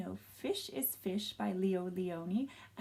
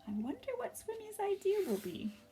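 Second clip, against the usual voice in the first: plain reading voice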